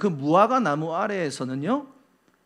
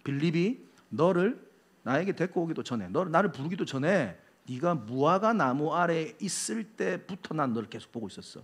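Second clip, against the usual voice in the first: softer, measured and lower-key; quiet knowing answer; 3 semitones lower, 8 dB quieter than usual. Acted. Jesus telling Nathanael he saw him earlier, quiet and assured. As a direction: deliver a revelation gently, understated.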